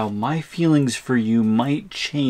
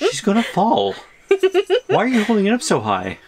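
higher and louder, laughing in alarm; 11 semitones higher, 6 dB louder than usual. Real, not acted. Friend yelps with a laugh as the jar goes too high. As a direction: cry out half laughing as she tips.

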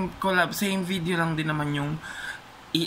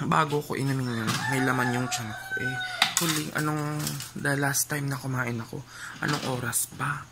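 ordinary talk

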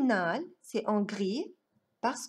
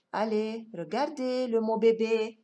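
ordinary talk

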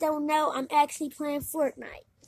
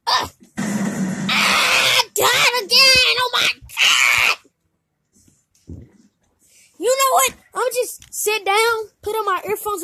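high furious yelling; raging gamer; 9 semitones higher, 7 dB louder than usual. Acted. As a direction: scream loud at losing again. Dies again in game.

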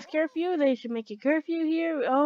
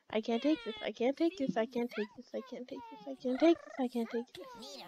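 soft, fretful looping complaint; fading repeated complaint; 8 dB quieter than usual. Real, not acted. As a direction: repeat it softly, fading away.

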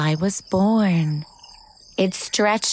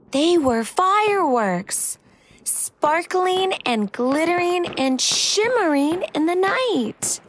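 exaggerated cartoon intonation; 7 semitones higher than usual. A narrator's reading. Reader marvels at fireworks.

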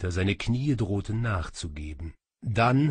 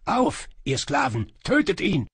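high, loud attack command; shouted order; 8 semitones higher, 6 dB louder than usual. A narrator's reading. Sorcerer orders his slaves to kill, high urgent shout. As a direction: shout it to drive them forward. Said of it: "rief Kaba seinen Äffchen zu".

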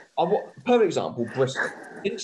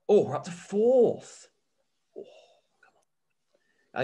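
delighted surprise; 7 semitones higher, 4 dB louder than usual. Real, not acted. Fan discovering the team is up to fourth, pleasantly surprised. Sees his team's league position.

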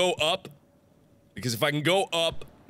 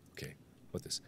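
whisper; 20 dB quieter, faster than usual. Real, not acted. Whispered check.

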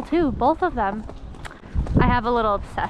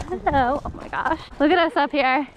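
ordinary delivery

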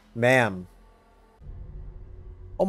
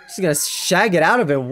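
usual speaking voice

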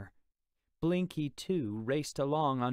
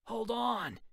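much higher alarmed cry; 11 semitones higher than usual. Acted. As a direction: yelp to stop them. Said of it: "Tubb cried".

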